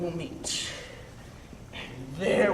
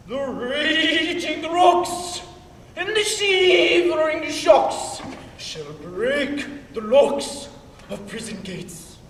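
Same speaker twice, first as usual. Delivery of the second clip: booming, slow Hercules rant; ranting tyrant; 5 semitones higher, 5 dB louder than usual. Acted. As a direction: bellow it like a stage tyrant. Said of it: "This was lofty".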